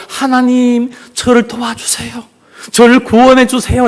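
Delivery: high-pitched, pleading prayer; earnest plea. Acted. Pilgrim facing a tiger, praying desperately. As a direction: plead for rescue, hammed up. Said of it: "그렇게 간절하게 기도드렸습니다".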